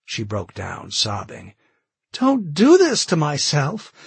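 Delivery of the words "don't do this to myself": high, slow, crying out on the edge of breaking; near hysteria. A narrator's reading. Carol throwing John's words back at him, near hysteria. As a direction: let it climb into a sobbing near-hysterical wail. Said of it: "she cried, her voice rising to near hysteria".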